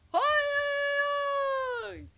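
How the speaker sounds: very high, loud, long-held holler; full-throated yell. A narrator's reading. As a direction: yell at the top of your lungs. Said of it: "на все горло закричав ведмедик".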